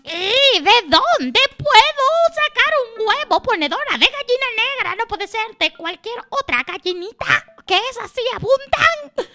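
very high, squawking complaint; protesting magpie. Acted. Magpie objecting to the water carrier, high squawky protest. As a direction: squeal your objection in a bird voice.

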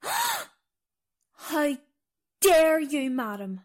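theatrically affronted, starting high; mock indignation. Guide offended by mistake about country.

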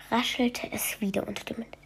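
hushed spooky storyteller whisper. A narrator's reading. Narrator telling a bedtime story in a spooky whisper. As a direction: breathe it like a secret in the dark.

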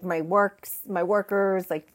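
usual speaking voice

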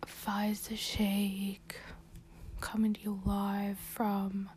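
soft unvoiced whisper, home podcast; hushed podcast whisper. Real, not acted. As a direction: whisper so no one hears. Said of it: "and not whisper".

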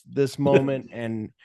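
ordinary talk

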